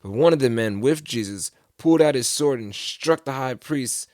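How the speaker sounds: usual speaking voice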